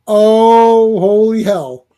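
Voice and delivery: mock-stunned, held on the first word; stunned reaction. Reader recalling his shock at the comic, stunned.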